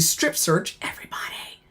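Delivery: hushed, voiceless imitation of screaming; whisper-yell the threat; mock scream. Hungry officer threatening inmates with a strip search, whispered mock scream. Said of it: "I'm screaming 'cause I'm hungry".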